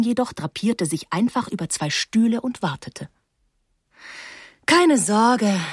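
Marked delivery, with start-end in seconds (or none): 4.7-5.6 lightly called reassurance; calling out. A narrator's reading. Newcomer reassuring the class, calling out. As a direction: call it out across the room. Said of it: "rief sie".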